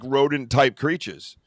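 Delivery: normal talking voice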